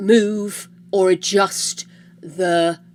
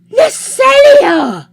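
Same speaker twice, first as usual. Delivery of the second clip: much higher, loud disbelieving cry; shocked; 12 semitones higher, 7 dB louder than usual. Real, not acted. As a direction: gasp it out in disbelief. Shocked at viewer's fall.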